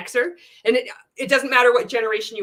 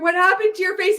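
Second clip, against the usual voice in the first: mock concerned friend; 11 semitones higher, 6 dB louder, faster than usual. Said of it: "someone says to him".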